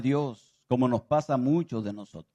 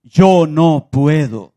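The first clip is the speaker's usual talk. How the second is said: loud reluctant no; 11 dB louder, much slower than usual. Acted. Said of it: "la reacción de él fue ese no".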